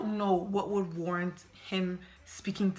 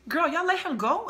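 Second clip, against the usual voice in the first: shocked; 9 semitones higher, 5 dB louder than usual. Real, not acted. Hearing the manager was fired, shocked and loud. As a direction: blurt it out in shock. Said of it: "I was shocked".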